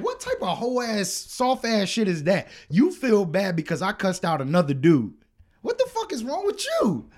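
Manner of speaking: high, scornful outburst; indignant. Retold or imitated. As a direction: rant in disbelief, ending very high. Acted indignant response to complaint.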